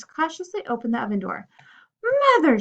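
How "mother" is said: high, loud horrified cry. Acted. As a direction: cry out in horror. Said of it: "she cried, horrified at what she saw".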